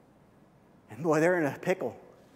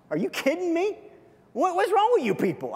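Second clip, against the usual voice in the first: put-on much higher, incredulous young shepherd boy; cocky attitude; 12 semitones higher than usual. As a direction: scoff at them like a cheeky kid who can't believe it. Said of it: "he kinda has that attitude".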